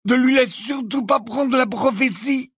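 high-pitched, vehement order; Neville, fierce. A narrator's reading. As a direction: insist fiercely in an altered voice. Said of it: "dit Neville d'un ton féroce". (ordre féroce, d'une voix aiguë)

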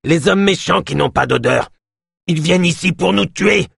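high, urgent cry of danger; alarmed warning. A narrator's reading. Yosp warns of approaching killers.

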